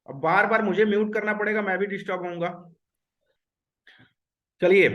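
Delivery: ordinary talk, nothing marked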